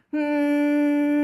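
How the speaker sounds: loud steady hum, sung on one note; demo hum. Shown as a demonstration. Teacher humming a demo note for students. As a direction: hum it out for the class to copy. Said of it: "hum after me".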